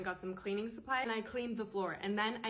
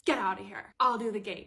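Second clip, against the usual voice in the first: loud, snapping get-out command; angry order; 8 dB louder than usual. Retold or imitated. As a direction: bark it at the girl stuck at the gate. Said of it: "she comes storming out".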